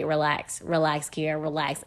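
soft, calming murmur of her gut; quiet inner voice telling her to relax; soothing inner voice. Retold or imitated. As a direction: hush yourself down gently. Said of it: "kept telling me".

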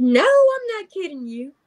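high, emphatic no I'm not; wife insisting to husband the dream was real. Retold or imitated. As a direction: insist through a grin. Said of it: "I said".

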